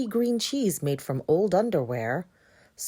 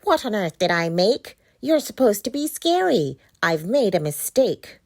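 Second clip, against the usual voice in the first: witch voice; 3 semitones higher, 6 dB louder than usual. Acted. The witch scolds.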